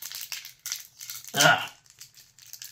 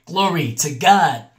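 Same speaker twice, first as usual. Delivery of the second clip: ordinary talk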